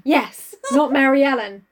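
ordinary talk, nothing marked

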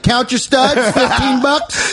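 slow sing-song stall barker; booth hawker. Pretend. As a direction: call out wares like a vendor. Hawking at a punk booth.

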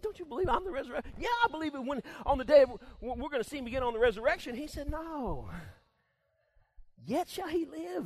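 preacher acts Jesus and Martha arguing; acted exchange.

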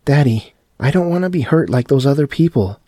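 a frightened daughter crying to her father; wailing. A narrator's reading. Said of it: "Nina wailed".

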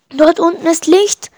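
high, loud excited cry; sighting rescue light after a long flight; hopeful shout. A narrator's reading. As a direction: shout out the discovery. Spots light in dark forest.

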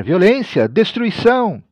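prophet's high cry of alarm; crying out a warning. A narrator's reading. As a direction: call out the warning, pitch leaping up. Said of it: "tenho de gritar e anunciar".

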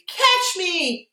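high-pitched mock scream at normal volume; imitating child's scream. Grandmother retelling her granddaughter's jump into the pool, mock scream. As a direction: imitate a toddler's gleeful cry before leaping. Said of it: "she screamed".

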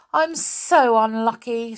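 sighed complaint. Acted. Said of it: "sighed Beth".

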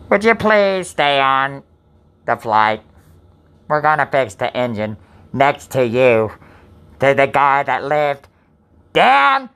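slow, mock airline captain over the intercom; pilot announcement. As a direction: play the pilot addressing the passengers. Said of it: "I'm trying to be the pilot".

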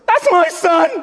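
high, excited shout of a turtle dad; proud daddy turtle. Retold or imitated. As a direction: crow over your son, high and laughing. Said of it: "And Daddy Turtle says".

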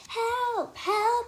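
high loud cries for help; screaming for help. Acted. Trapped girl screaming for help, high and loud. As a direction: shriek for help. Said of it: "I scream over and over again".

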